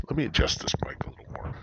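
fast quick whisper to himself; whispered aside. Real, not acted. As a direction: mutter it while fiddling with the mic.

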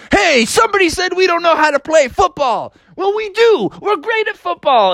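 high-pitched rowdy shout; brash challenge. Acted. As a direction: burst in and set the doubters straight. Characters burst in, challenging.

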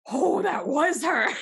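high, loud, drawn-out "oh"; excited realization. Retold or imitated. Roommate realizing who the caller was, excited. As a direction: stretch it out in high, loud amazement.

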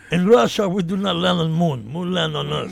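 a hint of Russian accent; lightly voiced Russian joke; heavy Russian accent menace.

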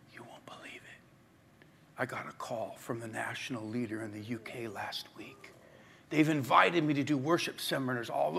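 low, very soft, near-whispered delivery; quiet retold revelation, almost whispered; hushed announcement. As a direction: confide it in a hushed, imitated voice. Retells the guest's hushed announcement.

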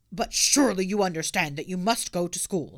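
stern officer; lightly voiced policeman.

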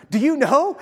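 laughing, mock-incredulous echo; sarcastic laughing echo. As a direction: scoff at the tactless question with a laugh. Mocking the prophets' news.

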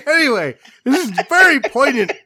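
high, laughter-squeezed words; real helpless laughter. Real, not acted. Host trying to get back to a poignant quote, laughing. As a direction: try to get serious but keep cracking up. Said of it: "That shouldn't have been that funny".

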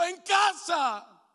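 clama fuerte y lento; grito de súplica. Acted. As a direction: exige a gritos. Prayer begging Jesus home. (grito lento)

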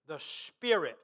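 "spirit" leaned on in a low, soft voice; stress on spirit. Real, not acted. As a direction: gently set "spirit" apart as the key word. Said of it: "I emphasize that word".

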